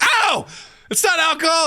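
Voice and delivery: high yelp of pain. Retold or imitated. Hot coffee poured on wound, high pained yelp. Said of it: "He's like".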